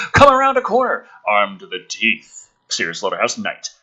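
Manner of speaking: ordinary talk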